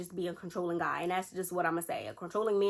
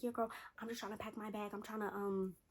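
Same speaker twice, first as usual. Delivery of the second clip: much softer, hurried hush; hushed sneaking; 10 dB quieter than usual. Retold or imitated. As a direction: whisper while secretly packing a bag. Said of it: "But imagine I'm whispering".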